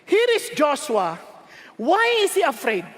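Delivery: ordinary delivery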